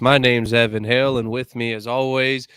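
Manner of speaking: loud, high announcer welcome, name drawn out slowly at the end; hyped show intro. Acted. Host welcoming viewers back to Center Ice, booming announcer voice. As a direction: open the show like a hockey arena announcer.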